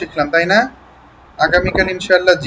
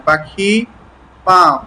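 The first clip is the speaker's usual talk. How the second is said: usual speaking voice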